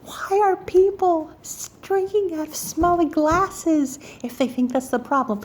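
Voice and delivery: high, incredulous outburst; exasperated. Retold or imitated. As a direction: wail the question in exasperation. Exasperated outburst at retold news.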